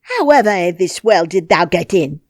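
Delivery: loud, gruff demanding question; gruff curiosity. A narrator's reading. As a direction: demand an answer. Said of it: "overpowered by curiosity".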